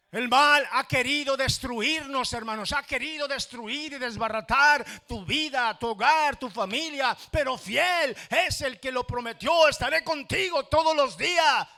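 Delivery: loud, high, near-shouted preaching; preaching at full force. Acted. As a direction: cry it out to the congregation. (predicación a todo pulmón, casi gritando)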